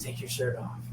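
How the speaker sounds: hushed, low come-on in jest; mock-seductive ad voice. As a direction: murmur it like a sultry voice-over parody. Sultry parody of the ad voice.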